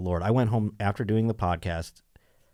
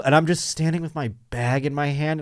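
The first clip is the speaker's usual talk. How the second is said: ordinary talk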